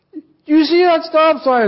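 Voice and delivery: high, put-on scolding wife; wife's scolding voice. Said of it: "she said".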